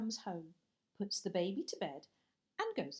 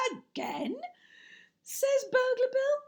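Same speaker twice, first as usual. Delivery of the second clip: much higher and louder, crying out; dismayed Burglar Bill; 8 dB louder than usual. Acted. Burglar with a banged nose, dismayed cry. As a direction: yelp it in pained disbelief.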